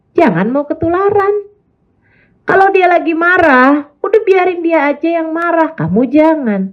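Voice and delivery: normal talking voice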